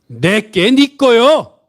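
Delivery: 내게 네 거요, each word slow and high; slow emphatic phrase. Shown as a demonstration. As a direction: spell out "내게 네 거요" slowly for the congregation to repeat. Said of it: "다시 한번 해볼까요?". (천천히 또박또박 강조해서)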